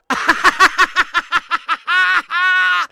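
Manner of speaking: high, loud disbelieving laugh; real laughing fit; loud burst of laughter. Real, not acted. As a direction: crack up at the miss. Reacting to awful penalty.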